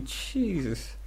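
ordinary talk, nothing marked

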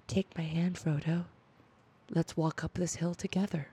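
soft, hushed mock-tender invitation; romantic. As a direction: imitate a gentle hero, dropping the volume. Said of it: "So romantic".

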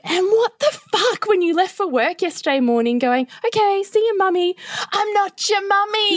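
high, exasperated mock outburst; furious retort. Wife retelling telling her husband off, high and exasperated. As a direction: fling it at him, fed up. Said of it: "And I was like".

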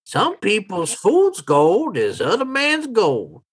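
put-on high, slow old hustler; old townsman, self-justifying. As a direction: justify yourself slowly in a high old-timer voice. Old man justifies fool's gold.

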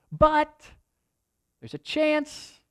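lightly voiced leper, pitch leaping high on chance; a hint of a desperate leper seeing hope; half-acted leper grabbing at a chance; hopeful leper. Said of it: "they're going".

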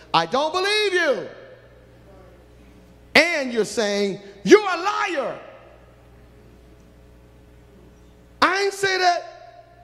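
a hint of a hammed-up liar; defiant sinner. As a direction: play the stubborn denier. Voicing sinner answering God.

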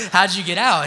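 loud, high, cracking up; laughing question. Retold or imitated. Preacher asking an ex-Jehovah's Witness friend, laughing loudly. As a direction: blurt the question out through laughter.

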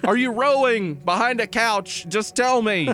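high-pitched, pleading frustration; exasperated caller. Acted. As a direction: question a silent mime in a high, exasperated voice. Acting exasperated caller talking to mime.

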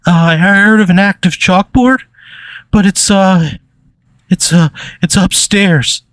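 high, timid, halting little voice; Shay. Acted. Pencil-shavings guy nervously offers a tip, meek and high. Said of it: "Shay kinda steps forward".

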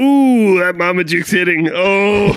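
high, loud comic moaning; mock bowel distress. Diner hit by Marmaduke, high loud moan. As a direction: wail it as if the meal strikes.

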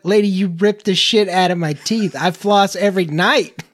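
a half-hammed outrage at the hygienist; indignant protest.

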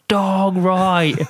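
warning shout. Retold or imitated. Cyclist shouting a warning to the team about a dog. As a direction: bark the alarm to the team. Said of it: "as I've shouted".

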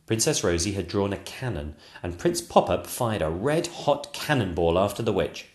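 ordinary delivery

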